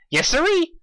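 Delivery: chirpy yes sirree, way up high; excited chirp; bubbly. A narrator's reading. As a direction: pop it out brightly. Pinkie cheerful.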